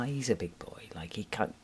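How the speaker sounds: drops to a whisper about the dog; breathy whisper, as if not to be heard; whispered aside. Real, not acted. Whispering to viewers about the big dog, hushed.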